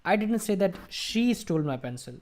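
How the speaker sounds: single out "she", keep the rest as it is; emphatic "she", even delivery elsewhere; stress on she. Shown as a demonstration. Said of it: "I just focused on she".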